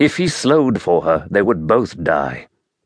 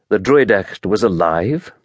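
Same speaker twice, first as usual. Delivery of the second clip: usual speaking voice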